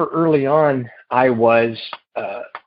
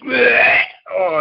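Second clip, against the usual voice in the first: imitating a high retching gag; hammed-up retch; mock retching; 11 semitones higher than usual. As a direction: act out gagging in disgust, well above your own pitch. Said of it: "he throws up again".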